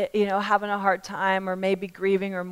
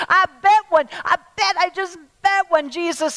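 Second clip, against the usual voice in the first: raised, higher-pitched sermon delivery; impassioned preaching; 5 semitones higher, 7 dB louder than usual. Acted. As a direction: preach it urgently to the whole room. Preaches at full force.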